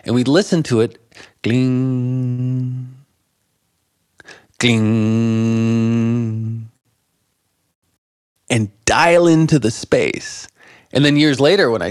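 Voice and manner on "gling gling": long held "gling" left to fade; sustained tone dying into silence; imitated ringing note. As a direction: sound a single steady note, leave space, sound it once more. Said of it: "we'd go".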